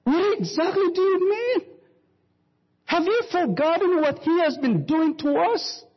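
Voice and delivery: high, pressing outburst; husband exclaiming at his wife, high-pitched. Acted. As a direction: demand answers, voice rising high. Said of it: "the husband exclaimed".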